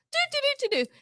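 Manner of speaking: high dopey dog babble. Retold or imitated. Voicing how the dogs sound, high mock babble. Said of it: "They're like".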